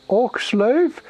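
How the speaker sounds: high, loud ogre question; simple-minded ogre, questioning. Acted. Ogre sizing up the visiting orc, puzzled. Game master voices an ogre.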